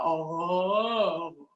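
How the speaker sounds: swallowed, throaty "Oh"; swallowed sound. Shown as a demonstration. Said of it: "being swallowed in your throat".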